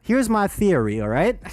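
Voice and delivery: usual speaking voice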